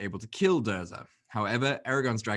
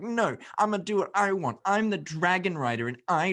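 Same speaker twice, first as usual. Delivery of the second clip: higher-pitched, hammed-up stubborn hero; mock defiant brat; 9 semitones higher, 3 dB louder than usual. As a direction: insist on getting your way, mockingly. Acts out a headstrong Aragorn.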